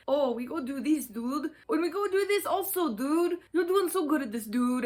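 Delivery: loud, hammed-up Spanish accent. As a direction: imitate an audiobook reader's Spanish-accented dude.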